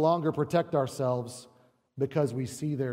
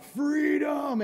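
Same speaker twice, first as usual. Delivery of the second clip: long high battle cry, much higher; defiant cry; 12 semitones higher than usual. Retold or imitated. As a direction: shout it like Wallace on the rack. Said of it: "he cries out".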